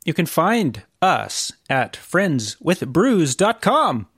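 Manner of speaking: slow, emphatic plug; slow announcer read. Acted. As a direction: announce the website slowly. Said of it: "sign-off announcement".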